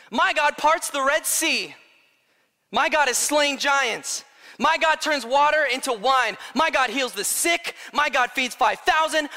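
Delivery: loud, high, impassioned declarations; relentless sermon crescendo, hammering each phrase; full-force preaching. Real, not acted. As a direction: shout each line like a rallying cry.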